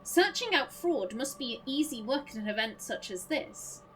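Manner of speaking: usual speaking voice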